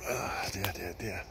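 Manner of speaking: breathy whisper of dismay; whispered sigh. Real, not acted. Sighing over the muddy mess.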